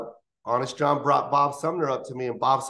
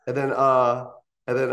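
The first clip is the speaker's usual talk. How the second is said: ordinary talk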